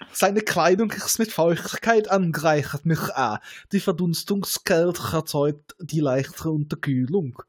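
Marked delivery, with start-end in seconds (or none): none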